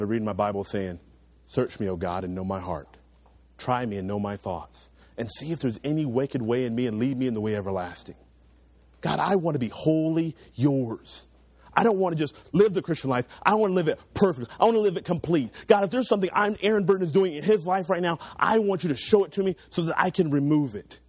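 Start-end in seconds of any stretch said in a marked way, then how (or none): 1.5-8.2 hushed prayerful reading; psalm recitation. Acted. 9.0-21.0 earnest pleading prayer; fervent spoken prayer. Acted. Prays aloud.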